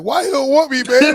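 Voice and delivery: loud, high-pitched hammed-up hurt plea; Will Smith moment. Son re-enacting being left by his dad, hammed-up hurt. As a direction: cry it out like a wounded son, half laughing. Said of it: "that Will Smith moment".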